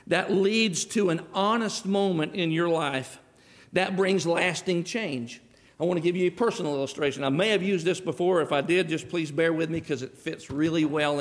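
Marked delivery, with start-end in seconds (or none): 0.1-5.4 slowed, weighty delivery; slow emphatic definition. Shown as a demonstration. Preacher stressing the definition to the congregation, slow. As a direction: drive home each phrase slowly. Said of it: "by definition, I want you to understand".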